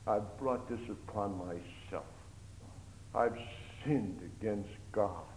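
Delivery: a broken prisoner confessing to himself; king in prison mourning his sins, quietly; remorseful lament. Acted. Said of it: "He began to think".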